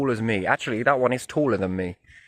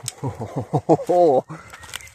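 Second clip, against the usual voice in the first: higher, louder laugh climbing in pitch; hooting laugh; 5 semitones higher, 5 dB louder than usual. Real, not acted. Walker reacting to a sudden stink, hooting laugh. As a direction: laugh out a rising string of hoots.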